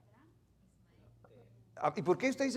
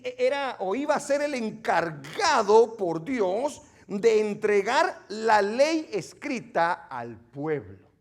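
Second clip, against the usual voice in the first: louder, fervent preacher's voice; preaching; 4 semitones higher, 7 dB louder than usual. Acted. Radio Bible teacher preaching Moses' calling to listeners, fervent. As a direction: preach it to the congregation.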